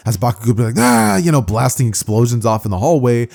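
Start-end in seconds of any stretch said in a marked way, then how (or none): 0.8-1.2 high exasperated "Ah"; frustrated yell. Acted. Bakugo blasting explosions in the hallway, frustrated yell. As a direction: cry out in mock rage.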